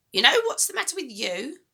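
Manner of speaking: put-on high, loud, bossy old woman; a mean grandma giving orders, high and loud; grumpy grandma voice. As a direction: nag the boy like a sour old granny. Grandma scolds.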